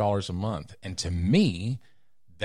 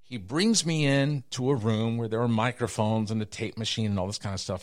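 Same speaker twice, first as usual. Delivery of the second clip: normal talking voice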